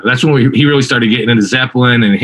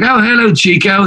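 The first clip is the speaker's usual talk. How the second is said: much higher, hammed-up British rock star greeting; 9 semitones higher than usual. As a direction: sing out the hello like a grand rock star. Teller voices [name] greeting drummer.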